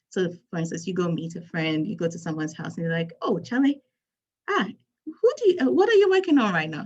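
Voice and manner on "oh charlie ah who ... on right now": put-on a first try at a Ghanaian accent, pitched higher and louder; a hint of a Ghanaian accent, higher and louder. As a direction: greet a friend in a Ghanaian accent that hasn't fully landed. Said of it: "I'm going to use my Ghanaian accent here".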